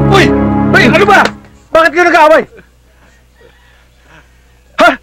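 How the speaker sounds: high, urgent calls to break it up; shouting to stop a fight. Acted. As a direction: yell at them to stop.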